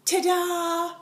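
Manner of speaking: put-on high, loud kid's ta-da; grandson's ta-da. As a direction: belt it out like a proud toddler. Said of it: "grandson goes".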